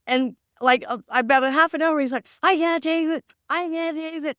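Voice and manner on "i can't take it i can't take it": high-pitched mock exasperation; high, overwhelmed complaint, imitated; retold meltdown. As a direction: act out someone at the end of their tether. Partner's meltdown acted out in retelling.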